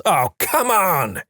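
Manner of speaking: high, drawn-out exasperated cry; mock yell. A narrator's reading. Man in the splash zone left dry, high mock yell. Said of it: "he throws his hat on the ground and yells".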